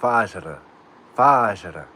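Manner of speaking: quick confident Fajr after the chat's advice. Shown as a demonstration. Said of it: "Say quicker".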